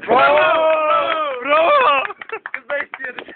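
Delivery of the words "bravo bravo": cheering shout. Pretend. Cheering the manager's promise.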